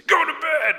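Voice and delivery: sudden high, loud parent yell; mock-loving yell. Parent sending the kids to bed, comically loud yell. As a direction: yell it like a fed-up parent at bedtime. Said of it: "then we lovingly say".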